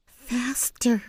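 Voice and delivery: high, breathless whispered urging; urgent whisper. A narrator's reading. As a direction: hurry her along without a sound. Girl urging while fleeing.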